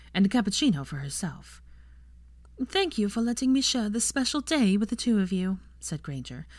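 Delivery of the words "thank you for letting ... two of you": higher, mock-solemn toast. A narrator's reading. Granger toasting Draco and his dessert, mock solemn. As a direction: deliver it like a wedding speech, tongue in cheek. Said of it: "with great solemnity".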